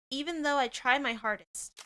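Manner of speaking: normal talking voice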